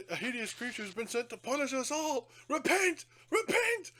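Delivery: climbing high, hammed-up preaching; fire-and-brimstone vicar. Vicar warning the congregation, hammed-up rising sermon. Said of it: "He's like".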